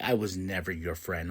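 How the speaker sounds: low, soft, understated retort; cold rejection. Retold or imitated. Seth rejecting his former friend Cody, quietly cold. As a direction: drop low and quiet to disown him.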